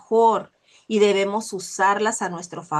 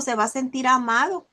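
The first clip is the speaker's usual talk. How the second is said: ordinary talk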